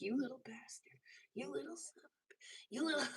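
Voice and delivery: whispered mock curse at the ring; muttered curse. Maker cursing a ring that slips, whispered under breath. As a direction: hiss it at the slipping stone. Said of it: "and I'm like".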